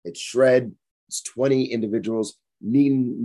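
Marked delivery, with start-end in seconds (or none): none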